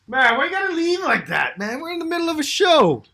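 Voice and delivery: very high, loud comic protest; wailing mid-show complaint, played for laughs; mock-outraged shout. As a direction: yell after someone walking off. Mock protest as co-host walks off.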